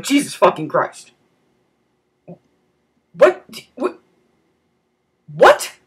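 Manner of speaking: shocked outburst. Retold or imitated. Startled by sudden screech.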